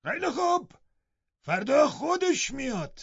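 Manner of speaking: very high, strained servant voice; high-pitched defiance from a surly old servant; loud defiant Joseph. A narrator's reading. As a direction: call out a threat while leaving. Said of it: "با صدای بلند گفت".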